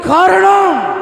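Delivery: a sudden high shout. Acted. Pastor crying out the cause to the crowd, shouting. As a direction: fling the word out. Climax of sermon point.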